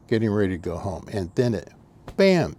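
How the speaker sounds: normal talking voice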